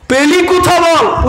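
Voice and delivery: high, loud, slow interrogation; loud slow accusing demand; demanding question. Retold or imitated. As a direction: grill the boy. Demanding where he got the dua.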